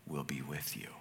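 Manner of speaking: hushed God, intimate; soft whispered reassurance from God; whispered promise. Retold or imitated. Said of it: "And God says".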